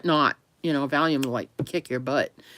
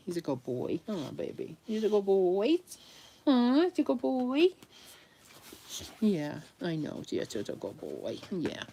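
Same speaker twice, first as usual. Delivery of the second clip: cooing at puppy; 11 dB quieter than usual. Real, not acted. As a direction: coo praise softly at the dog.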